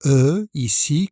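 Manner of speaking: usual speaking voice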